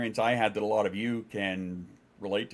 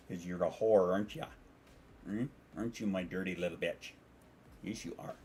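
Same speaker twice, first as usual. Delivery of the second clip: near-whispered coo to the cat; soft pet talk; 4 semitones lower, 7 dB quieter than usual. Real, not acted. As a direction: hush it to the kitty. Cooing quietly to cat.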